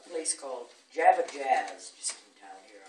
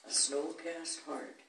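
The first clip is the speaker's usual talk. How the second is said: measured villanelle with raised, punched phrases; poetry recitation; 4 semitones higher, 8 dB louder, slower than usual. Acted. Recites own villanelle to audience.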